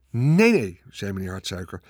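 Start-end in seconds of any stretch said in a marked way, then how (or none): 0.1-0.8 loud, higher-pitched protest; insistent denial; emphatic objection. A narrator's reading. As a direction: contradict her firmly and loudly. Farmer insistently contradicts his wife.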